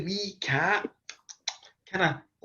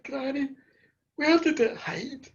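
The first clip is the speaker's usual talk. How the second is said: put-on high, small child's question; children asking granny; 5 semitones higher than usual. Grandchildren in bed ask granny, high childlike voice. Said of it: "we said".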